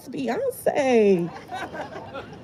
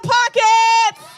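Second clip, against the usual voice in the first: rally shout; 9 semitones higher, slower than usual. Real, not acted. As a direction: fire the crowd up against Watchtower. Rally speech, rousing the crowd.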